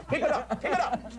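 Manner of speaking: high-pitched urging from the back of a plane; Italian guy cheering. Acted. Italian guy in the back urging on the monoplane, high cheering. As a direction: egg them on in a high voice. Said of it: "an Italian guy going".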